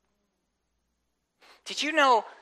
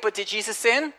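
ordinary talk, nothing marked

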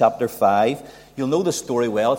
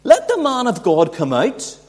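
high, loud, huffy protest; preacher voicing Naaman's scornful refusal, high and loud; indignant Naaman; 4 semitones higher, 5 dB louder than usual. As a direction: act out Naaman's offended pride. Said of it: "Naaman is going".

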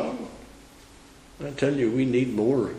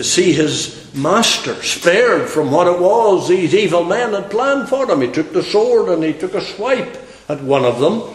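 loud, high, ringing pulpit preaching; fiery revival preacher, voice raised high; preaching at full force; 7 semitones higher, 6 dB louder than usual. Acted. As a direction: hammer it home to the congregation.

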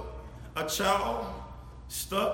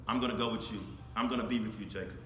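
gentle reassurance; 11 semitones lower, 7 dB quieter, faster than usual. Acted. God reassuring Jacob softly.

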